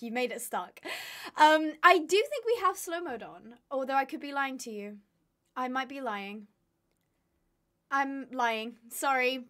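ordinary delivery

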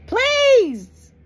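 a high, loud wailing please; mock desperate cry. Sinner mocked for begging God, wailing cry. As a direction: burst out in a hammed-up desperate shriek.

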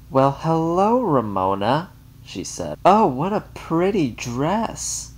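high, slow sugary greeting; Mary Jane gushing over Ramona; gushing to child. A narrator's reading. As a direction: talk down sweetly to a child.

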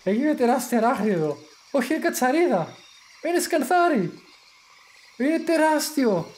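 growing astonishment at a giant beetle; excited. Real, not acted. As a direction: pitch climbs with each line, amazed. Spots a huge bug in game.

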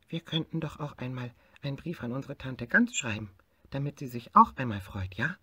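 a small tiger proposing a fun idea; little tiger voice. Acted. Little tiger wakes bear, eagerly suggests writing to aunt.

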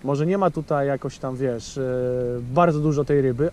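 ordinary talk, nothing marked

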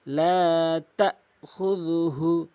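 slow chanted লা তাখুযুহু; measured recitation; slow recitation demo. Shown as a demonstration. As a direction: recite it slowly, each vowel held. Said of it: "এক আলিফ টানবেন".

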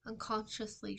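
usual speaking voice

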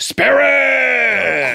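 hammed-up shout, high and drawn out; mock theatrical bellow; Al Pacino. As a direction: yell it as an over-acting film star playing Scrooge. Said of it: "he would just shout".

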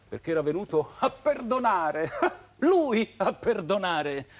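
derisive words broken by laughter; scornful laughing. Acted. Lover mocks the husband who came to forgive, scornful laughing. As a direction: laugh bitterly at the very idea.

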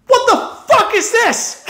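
high-pitched outraged yell; angry yell. Retold or imitated. Customer yelling at his barber after a bad cut, angry yell. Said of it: "I fucking yelled at him".

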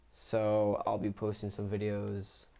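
ordinary talk

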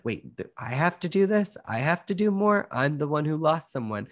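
high-pitched, incredulous objection; indignant protest. Acted.

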